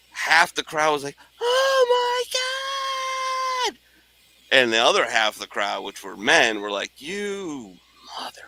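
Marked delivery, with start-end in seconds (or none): 1.4-3.8 mock squealing oh my God; delighted crowd. Crowd cheering the proposal. 7.0-8.4 whispered grudging curse; mock whispered insult; resentful men. Men in crowd cursing.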